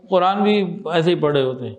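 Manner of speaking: ordinary delivery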